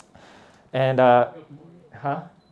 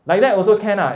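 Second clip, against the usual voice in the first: "Like that also can ah" louder and higher, warning fall on "ah"; disapproving; 6 semitones higher, 6 dB louder, faster than usual. Shown as a demonstration. Teacher shows class the scolding version, disapproving. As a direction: say it as a warning not to do it. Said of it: "Don't do that".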